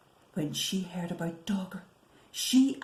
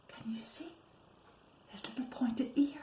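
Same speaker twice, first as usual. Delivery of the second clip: whisper; 3 semitones higher, 9 dB quieter than usual. Real, not acted. Reading to a child, whispering to point out the ear. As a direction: lean in and whisper the question.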